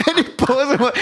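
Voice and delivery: high laughter into words; laughing. Real, not acted. Storyteller cracking up, high laughter. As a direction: laugh through it.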